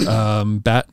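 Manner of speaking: ordinary talk, nothing marked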